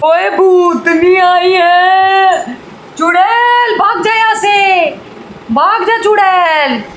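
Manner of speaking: high, drawn-out hammed-up cries; mock fright at a witch. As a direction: chase off the pretend witch, stretching every word. Playful chasing off witch child.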